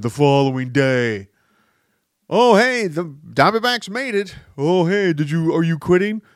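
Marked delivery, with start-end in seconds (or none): 2.3-6.2 loud, high, gloating jeer; mocking taunt. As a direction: rub it in, much higher and louder. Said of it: "And everyone's like".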